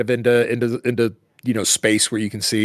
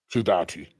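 flat, low robotic monotone; 6 semitones lower, 5 dB quieter than usual. Acted. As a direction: speak it like a machine. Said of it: "And then a robotic voice".

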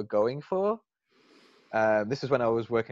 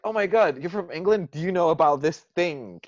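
gushing fan; 7 semitones higher, 4 dB louder than usual. Retold or imitated. Gushing American fan quoted.

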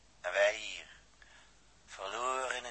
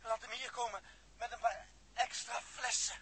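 short of air; 9 semitones higher, 9 dB quieter than usual. Acted. Running out of air, urgent.

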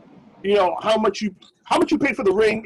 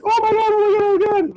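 high, loud imitation of an ecstatic fiancée; mock freak-out; 4 dB louder than usual. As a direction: imitate her losing it with excitement. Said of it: "she loses her shit".

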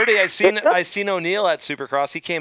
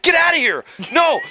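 retold shout; 10 semitones higher, 4 dB louder than usual. Retold refusal.

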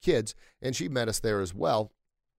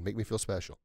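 softer, at his own pitch, unhurried; quiet; 7 dB quieter than usual. Shown as a demonstration. Speaker closing the message to listeners, softly. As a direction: let the words drop to a near hush. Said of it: "Say it loud, say it proud".

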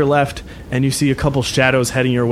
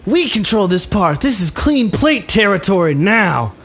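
put-on high, menacing young tough; raised-pitch put-on thug voice; gang leader; 6 semitones higher than usual. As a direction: stake your claim on the turf. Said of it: "the guy in the front walks up and goes".

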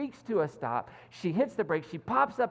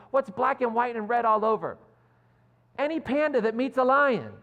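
triumphant retort; 6 semitones higher, 6 dB louder than usual. Retold or imitated. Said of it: "And she says".

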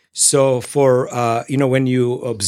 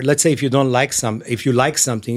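normal talking voice

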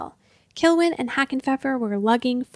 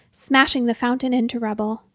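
plain reading voice

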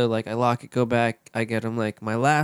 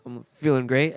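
normal talking voice

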